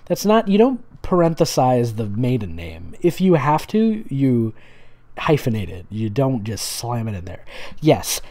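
ordinary talk, nothing marked

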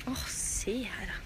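breathy whisper of awe; hushed aside. Real, not acted. Whispering to the camera while admiring the castle. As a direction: whisper it, taken with the view.

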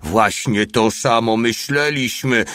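slow, called-out answer; equally loud reply. A narrator's reading. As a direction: call it back, stretching the words. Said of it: "odpowiedział mały równie głośno". (głośna, przeciągła odpowiedź)

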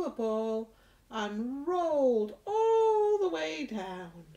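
lilting storytelling rhyme; reading to children. Acted. Teacher reciting Mousy Brown to toddlers. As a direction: tell it like a bedtime rhyme.

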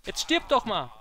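shouting at an enemy; frustrated shout. Real, not acted. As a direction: yell it in frustration. Enemy won't die in fight.

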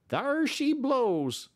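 high, slow, loud long call; Matt crying out. A narrator's reading. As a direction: sing it out as the steamboat appears. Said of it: "Matt cried".

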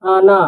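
"আনা" called out high and clear, both syllables full; Kazakh word demo. Shown as a demonstration. Teacher presenting the Kazakh word "আনা" to Bengali learners, high and clear. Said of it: "দেখুন".

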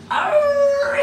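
high mock werewolf howl into a growl; hammed-up monster howl. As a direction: howl and snarl like a play werewolf. Imitating the werewolf to scare Rito.

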